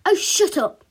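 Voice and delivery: loud, high, irritated retort; big sister snapping at her brother; cross outburst to silence him; angry snap. A narrator's reading. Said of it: "snapped her sister".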